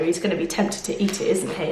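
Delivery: usual speaking voice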